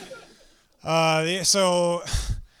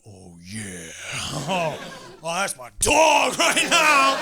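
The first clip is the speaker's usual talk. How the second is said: relieved triumph; slower than usual. Retold or imitated. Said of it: "I was like".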